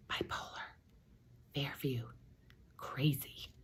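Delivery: soft unvoiced whisper. Crowd whispering about the sisters behind her. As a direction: gossip behind her back. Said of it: "people whisper".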